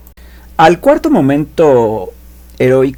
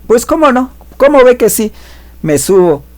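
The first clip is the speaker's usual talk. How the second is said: eager acceptance; 11 semitones higher, 4 dB louder than usual. Retold or imitated. Protagonist accepts the dare.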